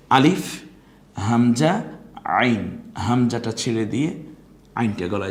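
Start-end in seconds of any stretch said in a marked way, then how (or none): 0.1-2.9 each letter loud and clear, rising; careful makhraj drill. Shown as a demonstration. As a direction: sound out alif, hamza, ain distinctly. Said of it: "অতএব". (মাখরাজ অনুযায়ী স্পষ্ট উচ্চারণ)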